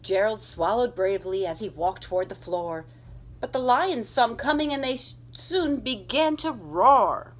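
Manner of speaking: put-on lively read-aloud to children; animated children's story reading; storybook narration. A narrator's reading. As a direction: tell it like a bedtime picture book. Reads picture book aloud.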